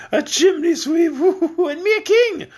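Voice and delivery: high, laughing through the words. Acted. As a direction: chuckle at the absurd joke. King laughs at his own joke.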